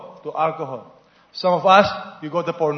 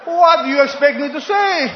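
mock outraged shout; 9 semitones higher, 8 dB louder than usual. Punchline of the horse joke.

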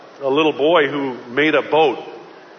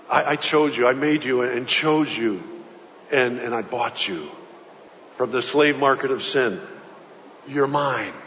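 softer, lower, a quiet tender assurance; gentle claim; 5 semitones lower, 6 dB quieter than usual. Acted. Preacher voicing God's quiet promise to his people, gently. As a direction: speak it like a father settling a child.

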